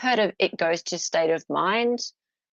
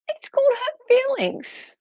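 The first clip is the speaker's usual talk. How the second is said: much higher, a lightly mocking voice; mock reply. Mocking the friend's answer, high-pitched and light. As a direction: toss back the answer in a silly high voice. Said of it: "Isn't it pain and suffering?".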